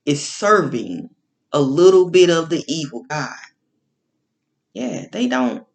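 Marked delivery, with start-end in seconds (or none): none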